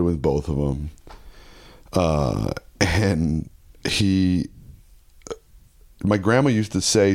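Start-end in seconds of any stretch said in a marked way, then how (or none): none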